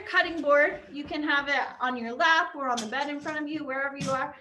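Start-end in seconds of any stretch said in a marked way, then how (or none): none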